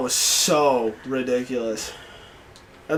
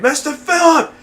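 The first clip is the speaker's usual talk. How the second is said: high, loud group shout; taunting shout, pitched way up; screamed taunt; 13 semitones higher, 7 dB louder, much slower than usual. Retold or imitated. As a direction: yell it across the playground. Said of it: "We all screamed".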